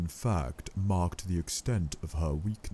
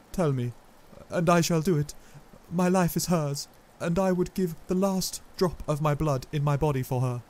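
ardent vow; 10 semitones higher, 6 dB louder than usual. A narrator's reading.

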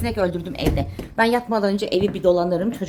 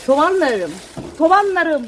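delighted; 8 semitones higher, 7 dB louder, slower than usual. Real, not acted. Greeting her onion plants.

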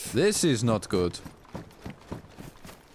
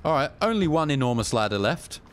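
ordinary talk, nothing marked

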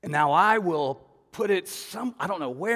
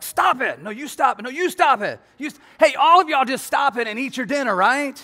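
put-on higher and louder, bickering kids then a parent cutting in; squabbling shout; 9 semitones higher, 10 dB louder than usual. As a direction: shout over the table noise. Kids squabbling, dad hushing them.

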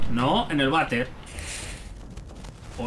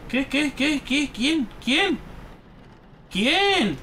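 alarmed questioning; 4 semitones higher, much slower than usual. Real, not acted. Player spooked by unseen presence.

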